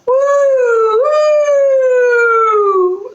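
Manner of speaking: high, drawn-out siren imitation; siren wail. A narrator's reading. Storyteller imitating a speeding fire truck, high siren wail. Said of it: "the siren blared".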